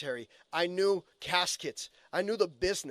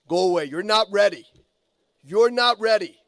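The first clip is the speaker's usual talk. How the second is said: loud, blunt dismissal, louder on not; imitating Scott's shout; 3 semitones higher, 11 dB louder than usual. Coach sending a worker off, loud mock shout. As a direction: bark it like the coach turning someone away. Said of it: "I'm sure you heard him say".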